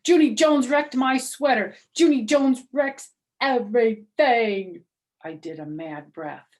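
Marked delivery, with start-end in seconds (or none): 0.1-4.9 loud, high, complaining grouch; grouchy. Acted. May complains to the teacher, loud and grouchy. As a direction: whine it like a furious kid. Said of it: "she grouched".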